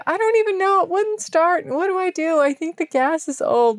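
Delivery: high, lost little voice; helpless plea. Retold or imitated. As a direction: play the clueless damsel asking for help. Retelling her helpless generator plea.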